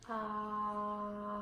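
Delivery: "Ah" held long and steady; one long open "Ah" on a single breath; sustained ah. Shown as a demonstration. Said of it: "say "ah" for me for five seconds".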